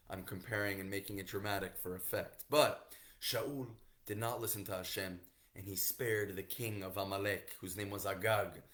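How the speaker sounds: ordinary delivery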